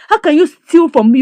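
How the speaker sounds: angry accusation. Retold or imitated. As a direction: confront the father who stole her money. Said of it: "The daughter said".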